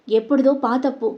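ordinary delivery

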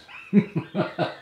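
loud breathy laugh. Real, not acted. Laughing at her own stupid chant. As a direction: burst out laughing.

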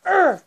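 short, loud high grunt; frustrated grunt. Real, not acted. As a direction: grunt hard as you fire. Straining grunt while shooting in game.

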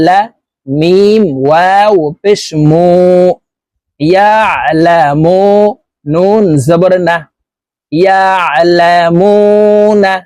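slow, measured syllable-by-syllable recitation; deliberate teacher's model recitation; Quran spelling drill. Shown as a demonstration. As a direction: spell out each letter evenly, then chant the joined word.

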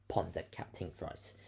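plain reading voice

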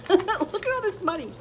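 high, loud and thrilled; loud, high gleeful exclamation; excited. Real, not acted. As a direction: show off the pile with glee. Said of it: "I'm so excited".

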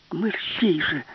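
high, sharp urging; urgent hurry. Acted. Alice urges the hesitant professor, high sharp call. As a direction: hurry him up.

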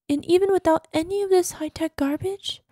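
usual speaking voice

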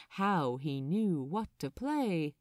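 usual speaking voice

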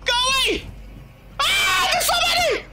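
high, frantic shouts of fright; panicked yelling. Real, not acted. Gamer shrieks curses at monsters jumping him, high and panicked. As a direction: yelp and curse as they swarm him.

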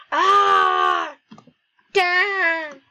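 high, loud drawn-out cry; hammed-up cry of distress; acted scream. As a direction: wail it out at full volume.

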